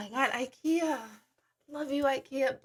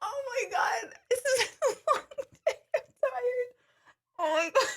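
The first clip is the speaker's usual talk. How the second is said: 1 tearful laughter; 10 semitones higher than usual. Real, not acted. Exhausted after laughing fit.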